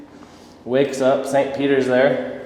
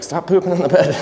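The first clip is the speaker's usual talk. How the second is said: high, exasperated wife's scold; wife scolding her husband as he wakes, with a higher pitched exasperated voice; 7 semitones higher than usual. Acted. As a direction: scold him like a fed-up wife.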